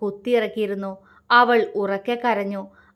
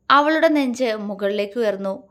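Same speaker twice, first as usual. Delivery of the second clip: ordinary delivery, nothing marked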